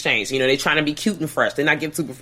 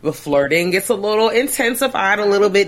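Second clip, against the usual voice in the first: ordinary talk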